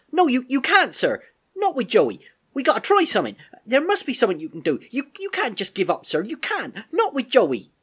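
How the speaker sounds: very high, loud, desperate objection; incredulous protest. Acted. Albert begging the Major not to give up on Joey, incredulous. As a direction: protest frantically, stumbling over words. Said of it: "Albert protested, still incredulous".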